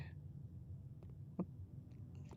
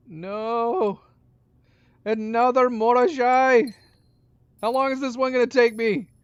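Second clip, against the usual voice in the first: high, loud exasperated outcry; exasperated shout; 9 semitones higher, 9 dB louder than usual. Real, not acted. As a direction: yell at the game in dismay. Another puzzle box appears.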